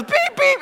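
very high, loud double beep; imitated censor beep. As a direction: bleep out swear words in a squeal-high pitch. Imitates censor bleeps over cursing.

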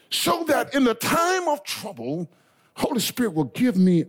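high, slow shouted line; preaching shout. Real, not acted. Preacher shouting the promise to the church. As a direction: belt it out to the congregation.

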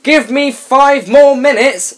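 high, slow mock-sleepy plea; sleepy whine. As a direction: whine from under the covers. Voicing sleepy lazy brother.

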